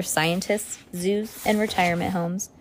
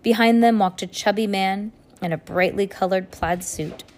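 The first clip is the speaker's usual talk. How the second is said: ordinary delivery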